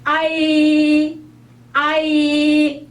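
아이 slowly, weight on 아; long stretched 아이 leaning on 아; stressed a in ai. Shown as a demonstration. Teacher showing 아이 slowly with stress on 아. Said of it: "소리 내어서".

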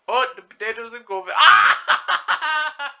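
high laughing yell; laughing shout. Real, not acted. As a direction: scream with laughter. Chaos in game.

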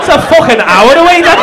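loud, incredulous ribbing; mock outrage. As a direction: tease him loudly, as if outraged. Mock outrage at a short drive.

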